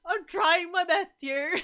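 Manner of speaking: high, loud, drawn-out mock wail; laughing, tearful protest; crying retold. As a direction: wail it slowly through laughter. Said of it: "to see you cry".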